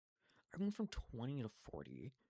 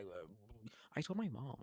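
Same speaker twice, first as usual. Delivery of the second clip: ordinary delivery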